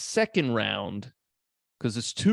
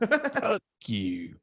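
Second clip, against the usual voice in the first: put-on Big Baby voice. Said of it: "That's what he says".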